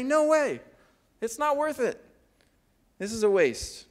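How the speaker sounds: scoffing disciples. Retold or imitated. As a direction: brush it off like a doubting disciple. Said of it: "and they say".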